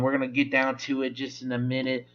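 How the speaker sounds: usual speaking voice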